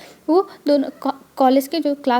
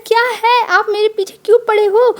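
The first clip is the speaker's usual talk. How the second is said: higher and louder, irritated retort; annoyed snap; 7 semitones higher, 5 dB louder than usual. Acted. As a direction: snap back at the nagging husband. Wing snaps at husband. (झुंझलाकर ऊँची और तेज़ आवाज़ में)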